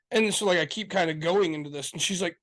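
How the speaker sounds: ordinary talk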